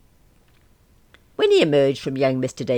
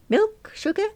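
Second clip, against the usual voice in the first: bright high secretary voice, quick; chirpy front-desk girl, high and fast; high receptionist voice; 3 dB louder than usual. Acted. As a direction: play the eager young receptionist, pitched way up. Receptionist asking client.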